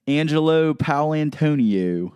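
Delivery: "Angelo Paolantonio" slow and high, with exaggerated Philly O's. Shown as a demonstration. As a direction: stretch every O in "Angelo Paolantonio", Philly style. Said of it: "They say it like that".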